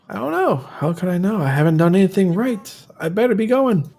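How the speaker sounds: high-pitched, flustered protest; hunted, frightened man. Acted. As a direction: plead it high, as someone who feels followed. Voices a fearful game character.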